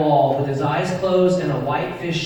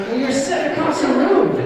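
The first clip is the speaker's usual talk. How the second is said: high-pitched mock exasperation; acted protest, pitched way up; exasperated retort; 9 semitones higher, 4 dB louder than usual. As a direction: fire back at a heckling kid. Exasperated reply to kid across room.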